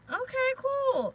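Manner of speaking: wry acceptance. Real, not acted. Accepting Spirit's hint.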